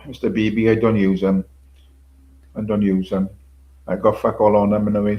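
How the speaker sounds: ordinary talk, nothing marked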